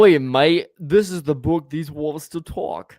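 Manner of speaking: put-on loud Australian accent, slow; Aussie voice repeated on request. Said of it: "always talk in an Australian accent".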